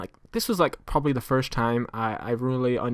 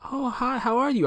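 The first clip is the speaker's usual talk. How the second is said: much higher, sing-song stranger voice; imitating the woman; 12 semitones higher than usual. Stranger walking up on the street, high mock-friendly voice. Retold stranger greeting speaker.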